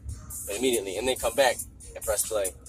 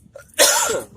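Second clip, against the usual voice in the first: loud rough hacking cough; a hard smoker's hack; 13 dB louder than usual. Real, not acted. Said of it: "Smoker's cough".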